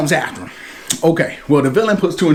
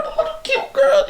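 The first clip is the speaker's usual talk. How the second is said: put-on squeaky high little girl voice, much higher than the first take; cute little girl voice. Mimicking the little foster sister, very high and squeaky. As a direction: say it like a tiny sweet girl.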